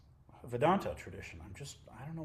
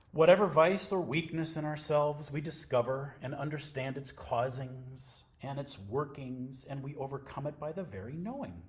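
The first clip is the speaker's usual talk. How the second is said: louder, deliberate reading voice; reading scripture aloud; 5 semitones higher, 10 dB louder than usual. Acted. To the sangha, reading Nisargadatta Maharaj aloud, louder. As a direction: read it out like a text for the room.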